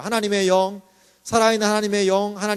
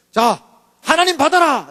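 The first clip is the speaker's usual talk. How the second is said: louder, higher, booming pulpit command; mock preacher command; 6 semitones higher, 6 dB louder than usual. Preacher booming at the congregation, mock command. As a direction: proclaim it like an odd altar call. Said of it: "이렇게 하면 어때요".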